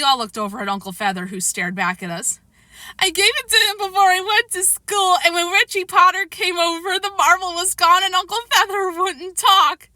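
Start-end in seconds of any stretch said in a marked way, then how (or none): 3.0-9.9 put-on tearful child. A narrator's reading.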